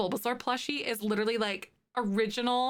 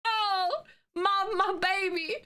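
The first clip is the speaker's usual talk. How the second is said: put-on much higher, doting baby-talk coo; 10 semitones higher, slower than usual. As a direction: coo like over a newborn. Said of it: "I'm like".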